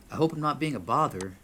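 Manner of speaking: calling out politely. Acted. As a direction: politely call out to someone unseen. Calls out to unseen bartender.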